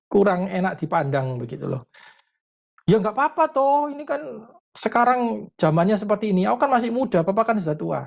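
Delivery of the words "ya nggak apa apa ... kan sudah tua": high-pitched imitation of a defensive kid; protesting teenager. Teenager justifying punk look to Dad, protesting in high voice. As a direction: plead the case in a high teen voice.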